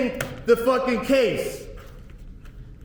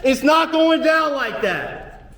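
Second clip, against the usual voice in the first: furious shout; 3 semitones higher than usual. Real, not acted. Defendant refusing the judge, furious shout. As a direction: spit each word out in defiance.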